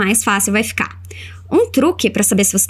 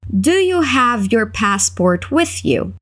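slow full sentence; much slower than usual. Shown as a demonstration. Teacher modelling the full question slowly. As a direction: Do you have your passport with you, slow and clear. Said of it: "vai repetindo comigo".

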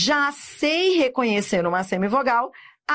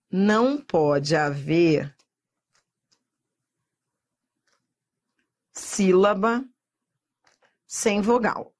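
very slow, long pauses between chunks; mantra ditado; 4 semitones lower, slower than usual. Shown as a demonstration. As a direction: dictate it piece by piece for learners to repeat. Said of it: "O primeiro mantra é". (mantra ditado bem devagar, com pausas longas)

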